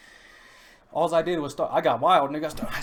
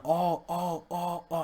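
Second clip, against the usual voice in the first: imitated yelling; 4 semitones higher, much slower than usual. Passenger yelling over and over, mock holler. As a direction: draw out each "oh" in a held-back yell. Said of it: "he's yelling".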